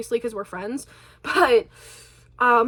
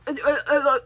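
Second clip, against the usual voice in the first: stammering, stuck on one syllable; imitated stutter. Child trying to answer mom back, imitated stuttering. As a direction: stumble helplessly on "uh" in a mock stutter. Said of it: "and I'm, like, stuttering".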